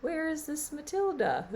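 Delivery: put-on high, curious child's voice with a laugh; wondering little girl's question. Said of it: "She was just curious and interested".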